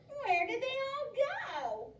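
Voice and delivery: high, slow witch call; witch shouting. Acted. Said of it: "Gritch shouted to a duck".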